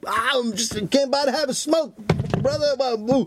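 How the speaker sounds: high-pitched mock junkie voice; hammed-up high, feeble cover story; caught thief's excuse. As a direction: imitate a flustered intruder making excuses.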